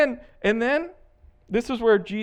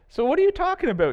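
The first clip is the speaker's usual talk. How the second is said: innocent question; 11 semitones higher, much faster than usual. Retold or imitated. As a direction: play dumb, brightly curious. Jesus voiced as innocent asker.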